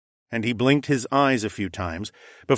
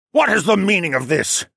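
higher, louder demanding voice; 9 semitones higher, 6 dB louder than usual. Acted. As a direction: demand an answer from the crowd. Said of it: "Huntington demanded".

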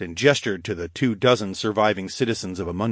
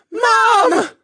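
put-on very high, loud child's yell; boy's high-pitched cry; shout; 10 dB louder than usual. A narrator's reading. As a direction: call out at full voice for a parent. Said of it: "they shouted in unison".

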